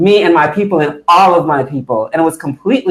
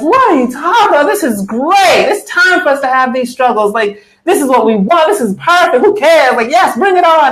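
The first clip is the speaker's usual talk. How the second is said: high, breezy, amused voice; mock cheerful Chairman, much higher; imitating Chairman laughing it off; 9 semitones higher than usual. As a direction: brush it off like it's great news. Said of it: "Chairman just laughed".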